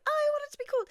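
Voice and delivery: very high little voice; squeaky self-quote. Retold or imitated. Host mimicking own wish for ice, squeaky self-quote. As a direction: quote yourself in a tiny squeak.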